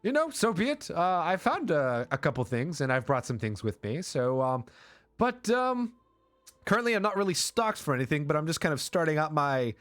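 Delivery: high-pitched, rambling newcomer; chatty merchant Travis. Acted. Merchant explains himself to wary adventurers, high and chatty. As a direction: introduce your little business in a high voice.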